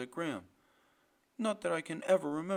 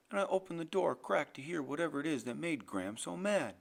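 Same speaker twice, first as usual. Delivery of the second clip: ordinary delivery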